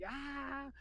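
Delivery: soft, high mock wail; imitated scream. Boy screaming while hugging mother, softly imitated. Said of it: "Yo nomás estaba grite y grite".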